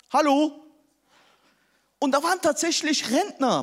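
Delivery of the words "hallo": higher and louder call; calling out at door. Retold or imitated. Pastor knocking at flooded houses, calling out loud.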